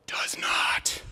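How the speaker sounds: whispered punchline. Real, not acted. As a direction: drop to a breathy whisper. Deadpan punchline on Trevor Noah.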